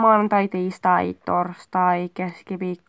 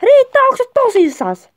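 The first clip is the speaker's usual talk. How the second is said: loud, high-pitched exasperation; fed-up outburst; 10 semitones higher, 5 dB louder than usual. Acted. Partner asking if the other is serious, fed up. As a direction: burst out high and loud, acting incredulous.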